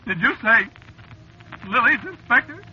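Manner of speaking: high, frightened voice; rising panic as he smells the lilies; scared. Acted. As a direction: driver realises the lilies are real, high with fear. Driver scared by ghostly lilies.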